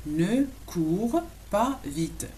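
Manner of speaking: "Ne cours pas vite" very slowly, a gap between words. Shown as a demonstration. Teacher teaches "don't run fast", slowly word by word. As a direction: draw out each word for learners.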